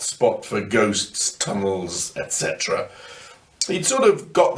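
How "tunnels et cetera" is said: plain reading voice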